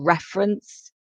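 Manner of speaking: "reference" stressed at the start, REF-erence; stress on first syllable. Shown as a demonstration. Teacher repeating a word for the class, "reference" stressed on the first syllable. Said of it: "with the stress on the first syllable".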